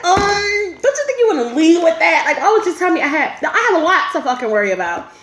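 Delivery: loud, high-pitched protest; indignant comeback. Retold or imitated. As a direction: call her out in exasperation. Retold indignant comeback to the nurse.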